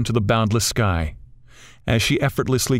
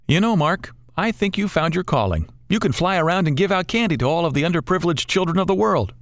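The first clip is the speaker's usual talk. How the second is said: teasing buddy, higher voice; joking friend; 8 semitones higher than usual. Acted. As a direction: quip at Mark with a grin. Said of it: "Rich quips".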